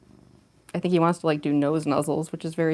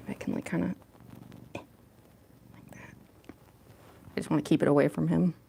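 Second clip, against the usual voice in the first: normal talking voice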